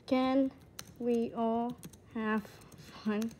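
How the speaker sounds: slow, with a gap between words. Pretend. Player asking everyone for fun, word by word. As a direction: space out the question word by word. Same question word by word, then whole.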